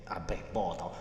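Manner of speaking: normal talking voice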